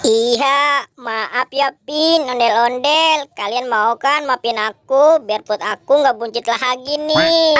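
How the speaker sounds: put-on low, gloating then groaning boy; low cartoon boy, hammed-up tummy ache; low greedy boy voice.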